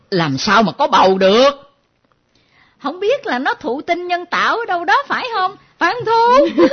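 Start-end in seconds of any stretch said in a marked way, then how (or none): 2.8-6.6 high, rising disbelief; shocked questioning. Acted. Mother questions pregnant daughter in high shocked disbelief. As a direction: ask it in shrill disbelief.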